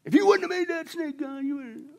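high, whining excuse; hammed-up Adam whining his excuse; whiny blame. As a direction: push the blame back onto God. Adam blaming God, acted.

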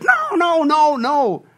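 a high, urgent "no, no" in a put-on voice; acted apostle's warning. James warning the drifting believers, high and urgent. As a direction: stop them in their tracks. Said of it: "And James says".

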